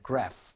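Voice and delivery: graph ending in an audible f; final F sound. Shown as a demonstration. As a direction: finish graph on a clear f. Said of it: "맨 마지막에 F 발음 나잖아요".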